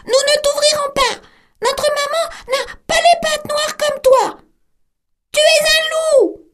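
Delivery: put-on squeaky kids shouting; young goats shouting at the wolf, very high; kids crying out. A narrator's reading. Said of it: "crièrent".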